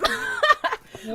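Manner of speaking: loud high burst of laughter. Real, not acted. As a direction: crack up at your own confession. Laughs at own confession.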